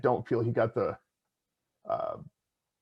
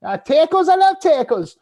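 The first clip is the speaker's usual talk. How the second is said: goofy high voice; 10 dB louder than usual. Pretend. Goofy voice right after accents.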